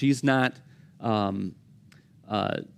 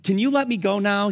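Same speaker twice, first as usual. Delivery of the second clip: pleading wrestler; 8 semitones higher, faster than usual. Retold or imitated. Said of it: "The man's like".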